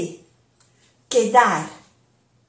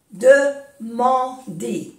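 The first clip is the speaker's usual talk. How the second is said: "Demander" in three slow, separate syllables; 3 dB louder, much slower than usual. Shown as a demonstration. Teacher repeating for learners, "Demander" slowly syllable by syllable. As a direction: pause between the syllables of "Demander".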